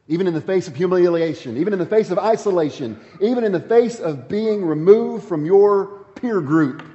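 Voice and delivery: preacher swelling to a fervent peak; building sermon climax. Acted. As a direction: drive each "even in the face" harder.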